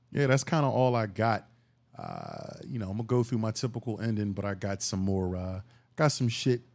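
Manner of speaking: ordinary talk, nothing marked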